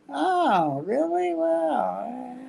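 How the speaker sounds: high, sarcastic oh really; mock surprise. Middle act mocks Lewis's lie.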